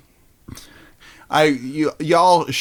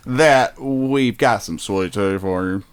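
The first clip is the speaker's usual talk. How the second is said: put-on southern accent; 2 semitones higher than usual. Host offering sweet tea in a mock Southern accent. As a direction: play the gracious Southern host. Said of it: "my southern accent".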